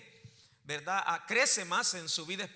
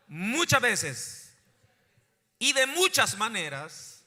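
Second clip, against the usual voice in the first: loud, slow scripture reading in chunks; congregation call-and-repeat; 3 semitones higher, 7 dB louder, slower than usual. Real, not acted. Preacher has congregation repeat Hebrews, loud and measured. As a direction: proclaim each phrase loudly, leaving room to echo.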